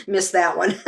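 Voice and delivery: laughing. Real, not acted. Laughing at own joke.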